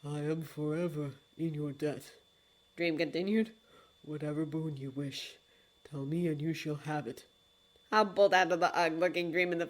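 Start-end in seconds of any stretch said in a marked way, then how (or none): 0.0-7.2 low, soft, solemn regret; a low, hushed apology; grave lord of dreams. Acted. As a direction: speak like a deep and remorseful old god.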